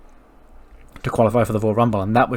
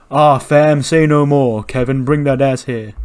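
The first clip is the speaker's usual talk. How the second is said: hyped; 3 semitones higher, 8 dB louder, slower than usual. Retold or imitated. Tez calling Kevin out, loud and hyped. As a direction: fire up and call him out.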